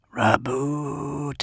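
drawn-out, ghostly crooning whisper; eerie whisper. A narrator's reading. Vox voice in the darkened hall, eerie whisper. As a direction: stretch the word out, high and eerie. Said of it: "the Vox whispered".

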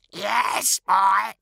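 high cackling crow voice. Acted. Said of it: "they kept cackling".

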